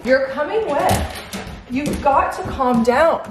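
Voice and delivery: high, slow pet-directed voice; calm an overexcited dog, drawing the words out; slow, high-pitched coaxing; talking to the dog. Real, not acted. Calming her excited dog.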